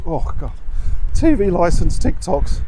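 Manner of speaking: usual speaking voice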